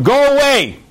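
high, loud mock yell of go away; yelled dismissal. Homeowner chasing off a collector, mock yell. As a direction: shout it like slamming a door.

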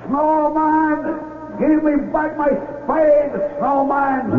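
high, drawn-out shouting calls; calling out. Acted. Villagers call out to Carlos.

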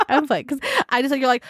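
usual speaking voice

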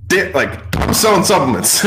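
half-laughing jealous exclaim; upset envy. Retold or imitated. Owner envying his friend's supplement money, loud and laughing. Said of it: "so, like, upset".

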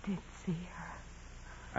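barely voiced, slow insistence; weak whisper. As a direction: breathe the words out from the deathbed. Dying nun insists, whispering.

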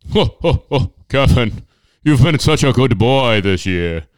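ho-ho-ho it up like a cheery Santa; jolly Santa. Pretend. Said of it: "almost an Irish twang to it".

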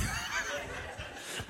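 a soft, airless chuckle after a flat joke; breathy laugh at own joke. Real, not acted. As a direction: laugh quietly at your own line.

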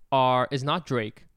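ordinary talk

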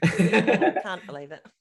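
loud, slow laughing disbelief. Real, not acted. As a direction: laugh it out, drawn out. Laughing tease about running.

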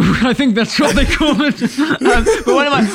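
giggling through the words; laughing. Real, not acted. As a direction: talk while still laughing. Laughing after barbecue joke.

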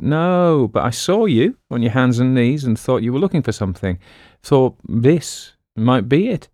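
mock-innocent explanation, lightly acted; lightly voiced innocent Quaid. Quaid offers the sergeant a wire, lightly innocent.

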